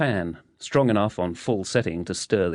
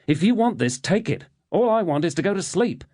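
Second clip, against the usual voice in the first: higher, pleading frustration; fed up and begging for sleep; exasperated; 10 semitones higher than usual. A narrator's reading.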